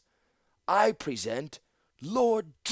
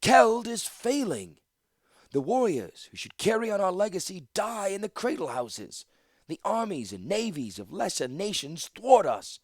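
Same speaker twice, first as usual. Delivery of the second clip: high, forceful public oration; rally the crowd with a grave warning; ringing leader's speech; impassioned declaration; 10 semitones higher, 5 dB louder than usual. A narrator's reading. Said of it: "Latilla declared as all eyes locked".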